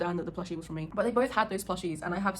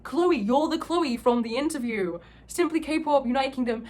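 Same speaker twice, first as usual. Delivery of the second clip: louder, drawn-out mock amazement; amazed recognition; 5 semitones higher, 6 dB louder, slower than usual. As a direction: imitate someone suddenly recognizing a fan. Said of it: "he was fully freaking out".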